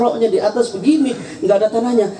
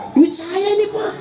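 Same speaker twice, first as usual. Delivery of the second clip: put-on higher, shouted old father's comeback; old father retorting; 6 semitones higher than usual. (bapak tua membalas dengan teriak, nada tinggi)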